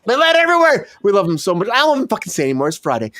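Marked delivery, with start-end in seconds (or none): 0.0-0.9 excited riff. Acted. Absurd story keeps escalating.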